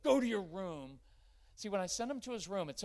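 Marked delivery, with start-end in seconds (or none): 0.0-1.0 loud, higher barked command; stern order. Retold or imitated. Parent ordering a misbehaving child away, loud and stern. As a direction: send the kid off sharply. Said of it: "Instead of".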